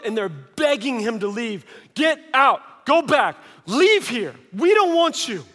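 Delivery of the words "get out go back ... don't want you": high, loud shouts of rejection; yelling. Acted. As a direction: drive him away with acted yells. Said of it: "they're yelling at him to go out".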